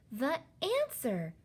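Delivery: high, drawn-out announcement; slow, high, triumphant exclamation; excited cry. A narrator's reading. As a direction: proclaim it with excitement. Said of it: "cried Sophie".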